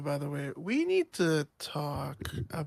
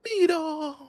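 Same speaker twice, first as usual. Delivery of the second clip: practiced reaction; 9 semitones lower, 7 dB louder than usual. Pretend. Practicing his loot reaction, mock shocked cry.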